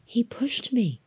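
hushed, breath-filled disbelief; amazed murmur. A narrator's reading. Boy accepts his hero betrayed him, amazed murmur. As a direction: murmur it as the truth sinks in. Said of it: "murmured Tom, amazed".

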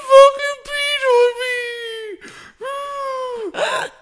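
very high, loud mock wail through tears; crying girlfriend shriek. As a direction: shriek it like she's sobbing. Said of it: "Breaks down in tears and goes".

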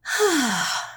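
long relieved sigh out. Retold or imitated. Mother unwinding with wine, relieved sigh. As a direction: let the day go in one breath. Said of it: "a glass of wine makes me go".

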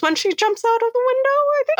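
high squeaky put-on voice; mock shriek. Podcast host squeaks the recap up high. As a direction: pitch it way up as a joke.

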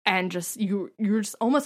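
ordinary talk, nothing marked

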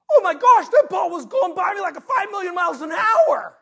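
high, loud, alarmed outburst; panicked exclamation. Retold or imitated. Preacher retelling his first Little League pitch, panicked yelling. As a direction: yell it in wide-eyed panic.

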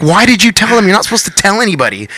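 high, wounded complaint; indignant protest. Retold or imitated. Singer scolds his band for telling, high indignant protest.

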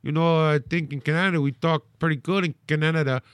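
put-on folksy Canadian accent. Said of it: "Do the Canadian voice".

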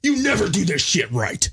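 high-pitched mock scolding; mock angry boss. Host imitating colleague berating the crew, mock angry. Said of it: "he goes".

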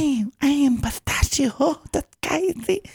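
terrible, very high-pitched character voice; high, ear-grinding goofy foreigner; Pistachio. Acted. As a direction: squeak out a grating bad impression. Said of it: "his worst impression was his normal voice".

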